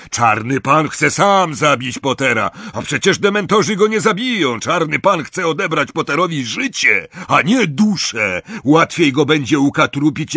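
loud, high arguing voice; loud argument. Acted. Death Eater argues with the others about killing Potter, loudly. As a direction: argue the point hard and loud.

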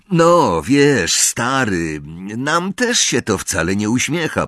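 ordinary delivery

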